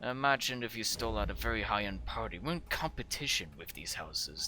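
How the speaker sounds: low, soft and tired; a sighing father refusing softly; weary father. Acted. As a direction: let him down gently. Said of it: "He sighs heavily and says".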